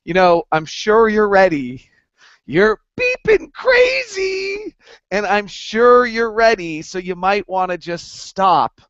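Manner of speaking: lightly voiced exasperated wife; a hint of a wife telling him to stop. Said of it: "my wife kinda said to me".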